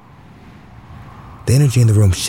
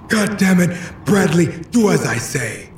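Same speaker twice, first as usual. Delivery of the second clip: angry father; 10 semitones higher, 3 dB quieter than usual. Acted. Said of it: "He was angry".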